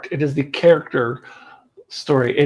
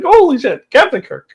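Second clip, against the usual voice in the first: high, loud mock shock; hammed-up amused astonishment; startled reaction; 10 semitones higher, 4 dB louder than usual. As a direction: blurt it as if jump-scared. Acted reaction to jump scare.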